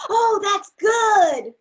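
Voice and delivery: high, loud, drawn-out cheer; excited slow cheer. Acted. Class cheers the boy's lucky landing, drawn out and excited. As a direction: stretch it out with delight.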